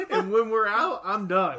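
high, loud words through laughter; real laughter, loud and high; laughing. Real, not acted. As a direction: laugh while you say it. Laughing while talking.

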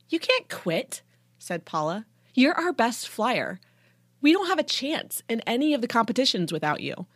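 high, dismayed objection; dismayed protest. A narrator's reading. Paula objects to teammate quitting, dismayed. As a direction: protest with pitch way up. Teammate begs flyer not to quit.

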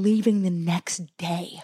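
slow, whispered aside. Real, not acted. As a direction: breathe it like a secret.

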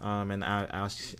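ordinary talk

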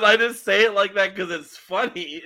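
can't hold back the laugh; laughing while quoting. Real, not acted. Retelling Walt's answer to the drummer, laughing. Laughing through retold reply.

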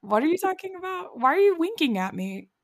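high-pitched confused questioning; exaggerated puzzled lilt, pitched high; mock puzzlement. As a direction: imitate someone obliviously confused. Imitating students missing the hint.

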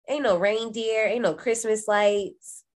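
normal talking voice

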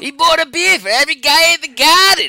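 put-on loud, high crappy Boston accent; hammed-up Boston accent, shouted. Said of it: "a crappy Boston accent".

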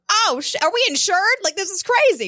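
high, startled disbelief; mock panic. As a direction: squeal it like the fire just caught. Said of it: "look at each other like".

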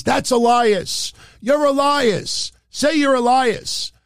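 high, drawn-out mock yell. Wrestler taunting the fans about his rival's identity, yelling. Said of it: "He's yelling to the fans".

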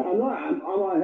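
ordinary talk

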